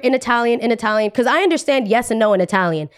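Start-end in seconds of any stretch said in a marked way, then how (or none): none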